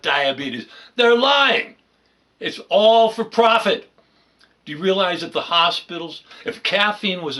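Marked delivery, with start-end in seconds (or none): 1.0-3.9 loud, high, indignant rant; angry outburst. Real, not acted. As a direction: shout it out in outrage. Ranting against doctors' profit.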